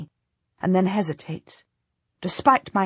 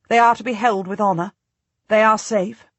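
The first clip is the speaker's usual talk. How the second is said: insistent; 4 semitones higher, 5 dB louder than usual. A narrator's reading. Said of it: "I insist".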